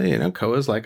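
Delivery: plain reading voice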